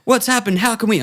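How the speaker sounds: high, loud and rushed; urgent paramedics. Acted. Paramedics arriving at the body, loud and high. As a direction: burst in asking urgently. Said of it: "they're like".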